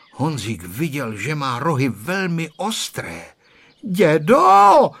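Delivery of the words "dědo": loud, very high drawn-out shout; repeated frightened cry. A narrator's reading. Boy facing sharp goat horns, shouting for grandpa. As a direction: cry out for grandpa at the top of a child's voice. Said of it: "vykřikl znovu Honzík".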